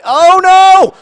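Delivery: high, loud, drawn-out oh no; real alarmed cry. Real, not acted. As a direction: yelp in real alarm at the game. Keeper faces a penalty.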